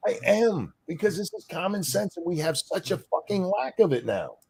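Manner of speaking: high, exasperated venting; angry. Real, not acted. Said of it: "You sound angry".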